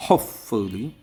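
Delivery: Hopefully with হোপ hit hard; stress on first syllable. Shown as a demonstration. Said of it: "হোপের পরে আমরা stress দেব".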